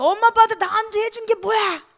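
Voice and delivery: high, hammed-up child's protest; acted high-pitched angry complaint; mock teenage outburst. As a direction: yell at your parents like a sulky kid. Acts rebellious child yelling at parents.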